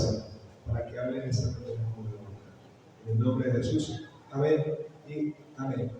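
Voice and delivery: soft, earnest prayer voice. Acted. Pastor praying for the congregation, soft and earnest. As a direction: pray quietly and humbly before preaching. Opening prayer before the sermon.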